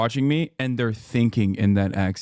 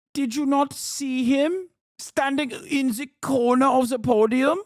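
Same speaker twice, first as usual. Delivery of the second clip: high, hammed-up German fanboy; German accent; 10 semitones higher than usual. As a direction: boast in a mock German accent, pitched way up. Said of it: "you are doing the accent".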